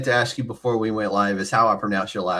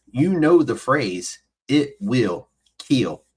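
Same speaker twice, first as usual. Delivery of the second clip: slow dramatic intro; 2 semitones higher, slower than usual. Acted.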